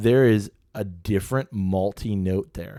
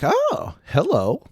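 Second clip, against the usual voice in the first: surprised; 10 semitones higher, much slower than usual. Retold or imitated. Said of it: "there's enough proof where you're like".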